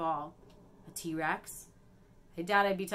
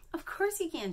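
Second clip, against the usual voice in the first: exclaiming; 10 semitones higher than usual. Acted. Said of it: "she cries".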